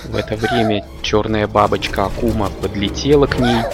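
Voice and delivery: ordinary delivery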